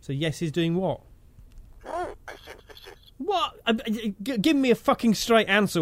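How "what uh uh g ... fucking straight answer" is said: exasperated. Real, not acted. Frustrated at evasive toy.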